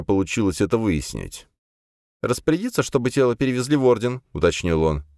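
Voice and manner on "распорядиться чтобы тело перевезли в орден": put-on young adept voice. Adept asks.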